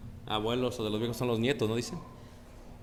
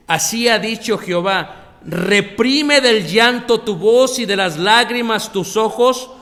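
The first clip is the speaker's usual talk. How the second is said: loud preaching; 5 semitones higher, 9 dB louder than usual. Acted. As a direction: proclaim the prophecy at full voice. Preaches Jeremiah's prophecy loudly.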